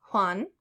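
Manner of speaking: "Juan" said as "hwahn"; J as English H. Shown as a demonstration. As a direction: start "Juan" with a breathed H. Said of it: "pronounced like the English H".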